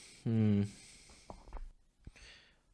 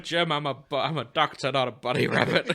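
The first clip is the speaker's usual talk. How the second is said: loud, mock-exasperated doctor quote that breaks into a laugh; McCoy impression; 5 semitones higher, 12 dB louder than usual. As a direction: bellow it like the grumpy starship doctor, laughing through the end. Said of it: "Leonard H. McCoy, M D".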